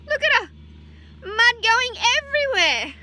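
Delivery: high, loud delighted exclaim. Real, not acted. Parent showing the child the cow splashing out, delighted. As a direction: point it out with glee.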